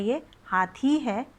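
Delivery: हाथी said slowly, थी held; slow long vowel. Shown as a demonstration. Teacher models the right way: हाथी, slow and long. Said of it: "हाथी नहीं है".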